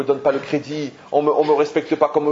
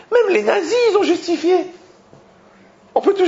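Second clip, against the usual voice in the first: laughing outburst; 4 dB louder than usual. Real, not acted. Rabbi lecturing the violent husband.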